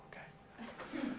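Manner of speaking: whispered okay. Retold or imitated. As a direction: barely whisper it. Reply whispered.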